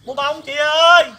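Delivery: loud seller's call. Acted. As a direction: call out to passersby. Street seller calling out.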